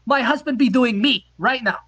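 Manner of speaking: the Norwegian woman pushing her offer; mock Norwegian accent. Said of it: "this accent is".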